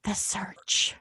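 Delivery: hushed dramatic whisper. Pretend. Host whispering the episode title to her sister, hushed. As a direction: breathe the title like a secret.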